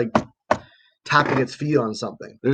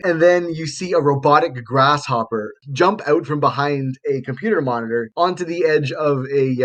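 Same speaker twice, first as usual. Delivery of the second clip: ordinary talk, nothing marked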